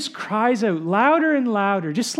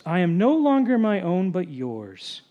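reverent prayer reading. Acted. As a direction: read it as a solemn vow, hushing toward the end. Reads a covenant prayer aloud.

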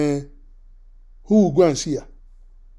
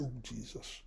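hushed dismay; 8 semitones lower, 26 dB quieter than usual. Retold or imitated. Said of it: "I said".

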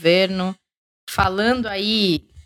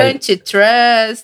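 fake English; 3 dB louder, much slower than usual. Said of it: "o meu embromation aqui".

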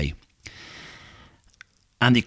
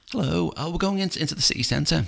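lightly voiced girl, higher and softish; young girl passenger; 10 semitones higher than usual. Girl passenger greets the taxi driver, lightly imitated high voice. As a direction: raise the pitch a little for a polite girl.

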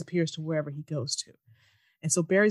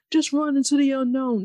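louder, higher mock wise line; mock mentor speech; 7 semitones higher, 6 dB louder than usual. Mocking the mentor's speeches, louder hammed-up wisdom. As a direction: ham up the hollow advice. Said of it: "This leads to nothing".

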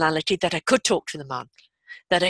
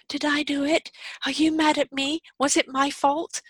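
lightly voiced anxious child, higher pitch; worried child; 7 semitones higher than usual. Child wondering if the parents are upset, worried and high-pitched. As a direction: ask in a small child's higher voice. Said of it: "they're immediately going to think".

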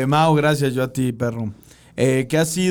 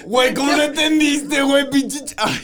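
high-pitched exasperated outburst; pitched high, breaking off in exasperation; exasperated disbelief; 11 semitones higher than usual. Real, not acted. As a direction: protest incredulously, voice jumping way up. Exasperated that friend missed it.

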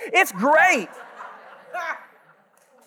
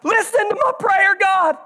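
anguished prayer; 4 semitones higher than usual. Shown as a demonstration. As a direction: pour out your soul to God, crying out. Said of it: "That's the, the attitude here".

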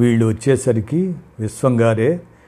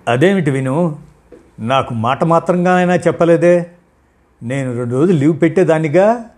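raised, annoyed wife's complaint, higher and louder; a bit angry; 6 semitones higher, 5 dB louder than usual. A narrator's reading. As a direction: push back irritably at her husband. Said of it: "కాస్త కోపంగా అంది". (కాస్త కోపంగా, గొంతు పెంచి)

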